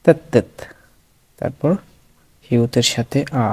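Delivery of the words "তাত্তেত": তাত্তেত closed on a ত, higher pitch; final ত sound. Shown as a demonstration. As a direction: say তাত্তেত with a doubled ত and a ত ending. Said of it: "ত উচ্চারণ হবে". (তাত্তেত, শেষে ত উচ্চারণ করে)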